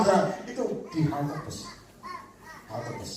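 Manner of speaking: ordinary delivery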